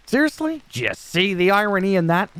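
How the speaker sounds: high, squeaky disbelief; incredulous. Real, not acted. Mocking the boss for judging looks, high squeak of disbelief.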